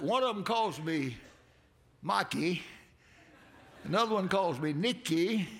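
ordinary talk

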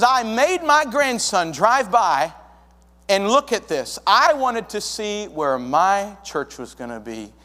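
a grandmother gushing about seeing her church; eager old lady. Retold or imitated. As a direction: beam with pride over your new church. Beverly's eager reply voiced.

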